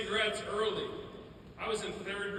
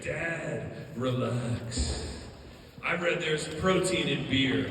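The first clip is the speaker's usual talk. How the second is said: slacker brother impression; 5 semitones lower, 5 dB louder, much slower than usual. Brother brushes off Dad about drinking, mock slacker voice. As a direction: lightly imitate a laid-back Rick-style voice. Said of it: "He had that voice".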